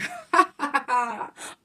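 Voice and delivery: greedy little cackle; mischievous giggle; gleeful laugh. Acted. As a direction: laugh like a spoiled villain. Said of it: "laughed with glee".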